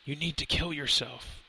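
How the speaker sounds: soft, breathy dying murmur; shallow breaths. A narrator's reading. Dazed professor urging Lenny to kill, soft shallow breaths. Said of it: "he said with shallow breaths".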